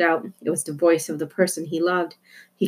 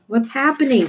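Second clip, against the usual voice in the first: shout; 7 semitones higher than usual. Acted. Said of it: "someone shouted".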